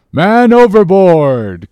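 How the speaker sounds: high, loud, slow call of alarm; drawn-out sailor's cry of man overboard. Acted. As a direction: bellow the warning across the deck. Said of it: "cried Dago".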